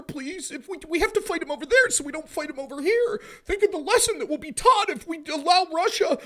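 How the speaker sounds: high, loud, whiny begging for billions; shrill pleading mockery. Mocking pro-war voices begging the public for billions. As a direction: beg like a desperate caricature, rising to a shout. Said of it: "And then they say".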